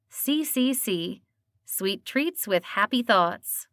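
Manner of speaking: ordinary delivery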